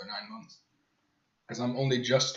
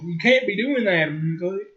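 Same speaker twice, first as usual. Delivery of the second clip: high, loud mock-teacher voice with a tic on the end; 6 semitones higher, 6 dB louder than usual. Students mocking their teacher's verbal tic, high mock voice. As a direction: mimic the teacher mockingly, pitched up. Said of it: "we started mocking her".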